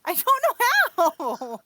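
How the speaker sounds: loud, high-pitched helpless protest; playful protest. Real, not acted. Streamer asked for an Irish accent, playful helpless protest. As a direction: protest loudly that it's beyond you.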